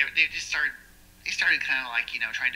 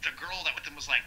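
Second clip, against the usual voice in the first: usual speaking voice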